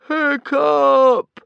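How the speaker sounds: long, high, loud anguished cry; despairing yell. A narrator's reading. Father yelling for son sinking with ship, despairing. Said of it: "yelled Stoic in despair".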